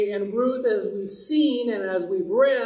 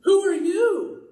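startled exclamation; 7 semitones higher than usual. Retold or imitated. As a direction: blurt it out like a man jolted from sleep. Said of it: "It's more like he says".

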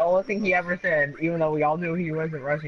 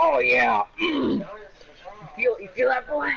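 gloating put-on voice; 7 semitones higher than usual. Hammed-up gloating voice.

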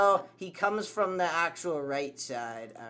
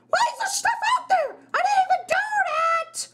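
panicked shriek; 4 dB louder, faster than usual. Real, not acted.

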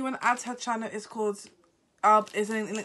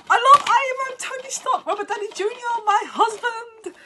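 gushing fan; 12 semitones higher, 6 dB louder than usual. Real, not acted. Fan gushing over Iron Man.

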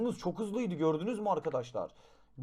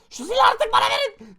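much higher, louder demand, voice going up; exasperated yell; 12 dB louder than usual. Real, not acted. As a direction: yell at the game to hand it over. Demanding the weapon back.

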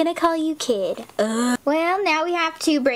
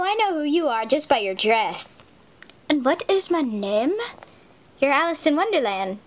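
ordinary talk

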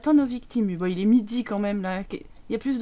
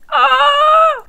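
frustrated yelp; 10 dB louder than usual. Real, not acted. Frustrated yell at game.

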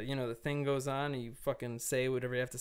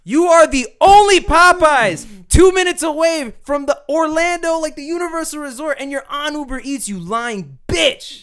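furious rant; 13 semitones higher, 15 dB louder than usual. Real, not acted. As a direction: yell it at her, furious.

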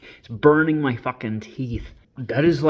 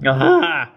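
sharp loud wince; loud pained ah; 6 dB louder than usual. Real, not acted. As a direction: cry out at the sour taste. Sip before rating flavor.

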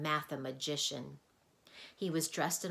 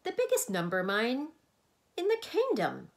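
rising, louder boast; proud; 8 semitones higher, 8 dB louder than usual. A narrator's reading. Mathemagician boasts to Milo, proudly. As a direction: swell with pride. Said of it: "said the mathemagician proudly".

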